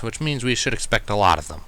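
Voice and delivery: usual speaking voice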